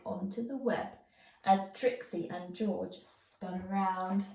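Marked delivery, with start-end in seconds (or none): none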